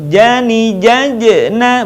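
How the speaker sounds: chanted "jajena" with an echoed j before na; bouncing J. Shown as a demonstration. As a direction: let the closed j in "jajena" rebound. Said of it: "Ketika mati suaranya mantul". ("jajena" dengan j memantul)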